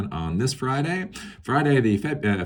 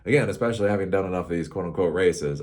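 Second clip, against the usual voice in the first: ordinary talk, nothing marked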